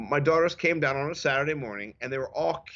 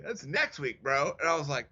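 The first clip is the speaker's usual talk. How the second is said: normal talking voice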